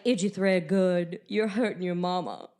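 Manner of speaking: slow, hammed-up Southern accent; a lightly put-on Southern movie accent. Shown as a demonstration. Copying a Southern movie heroine, in a slow hammed-up Southern accent. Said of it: "I learned how to do a Southern accent".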